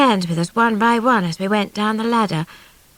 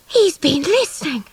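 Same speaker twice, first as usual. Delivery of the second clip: gasped; 6 semitones higher than usual. A narrator's reading. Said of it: "I gasped".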